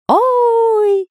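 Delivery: high loud oh of fright; straining cry. Acted. Spy still howling in the whirlwind, high strained cry. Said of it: "продолжал надрываться Дырка".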